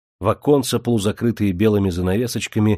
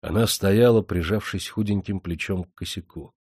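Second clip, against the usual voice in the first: usual speaking voice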